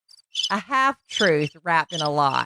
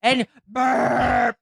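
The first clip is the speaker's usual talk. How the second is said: mock belch announcement; 4 semitones higher, much slower than usual. Wife imitating husband's belching song. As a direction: announce it like a showman.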